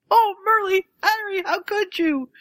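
high anguished cry; anguished wail. Retold or imitated. Ron grieving.

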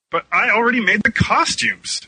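high-pitched protest; objecting. A narrator's reading. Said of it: "Shinny objected".

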